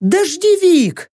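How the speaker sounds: put-on high, drawn-out girl's yelp. A narrator's reading. Girl runs in under the awning, high yelp. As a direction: yelp it high, stretching the word. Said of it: "вякнула вбежавшая под тент девка".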